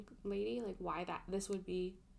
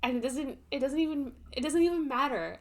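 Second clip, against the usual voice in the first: louder, exasperated rant building up; 6 semitones higher, 11 dB louder than usual. Real, not acted. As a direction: vent it out, louder each time. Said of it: "sorry for ranting".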